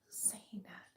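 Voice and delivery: hushed, unvoiced question; scared whisper to an empty room; startled whisper. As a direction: whisper it, spooked.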